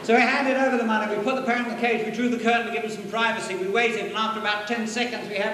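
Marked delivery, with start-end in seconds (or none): none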